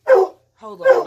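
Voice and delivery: loud, high call off to the side; shouting at the dogs to hold on; loud aside to dogs. Real, not acted. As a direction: tell the dogs to wait, loudly. Breaks off to quiet dogs.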